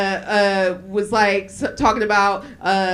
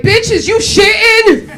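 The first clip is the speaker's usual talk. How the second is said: loud, high, drawn-out accusation; outraged shout; 11 semitones higher, 10 dB louder, slower than usual. Retold or imitated. Confronting her from the shower over the smell, outraged shout.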